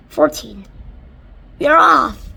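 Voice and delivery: loud high cry; shouting. Acted. Creature shouting that the peach leaves, loud high cry. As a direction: call out the launch. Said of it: "someone was shouting".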